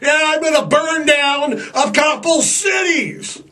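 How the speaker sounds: high shouted boast; hammed-up wrathful God; mock angry God. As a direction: bellow it like a gleeful tyrant. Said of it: "He's like".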